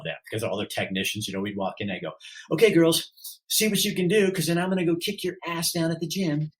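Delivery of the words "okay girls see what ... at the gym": higher, louder cocky teasing; cocky patient calling out to the nurses, loud and high; playful shout to the nurses. Retold or imitated. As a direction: call out to the nurses like a flirt.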